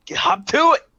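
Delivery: furious boss yelling at a subordinate; shouted order. Acted. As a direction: yell the order at him. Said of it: "she says".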